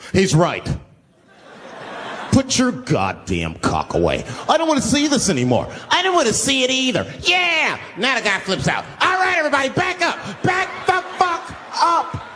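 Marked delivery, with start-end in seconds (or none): 0.1-7.8 hammed-up emboldened passengers; mock brave crowd. 9.0-12.2 much higher shouted threat; a hammed-up unhinged man yelling at passengers; angry shout. Homeless man flips out shouting.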